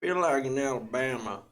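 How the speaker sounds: Southern, stretched out like Alabama; Southern accent. Shown as a demonstration. Performer asked for Alabama, Southern accent. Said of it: "do some Southern".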